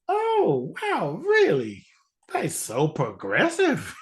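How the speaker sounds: high, exaggerated gasp of surprise; hammed-up wide-eyed discovery; mock amazement. Mock amazed grown-up realization.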